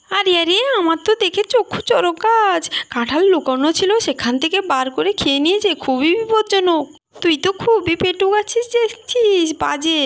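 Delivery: a woman aghast at the greedy eater; lightly voiced shocked aunt. Shocked woman scolding Bhim. (হতবাক হয়ে বকুনি)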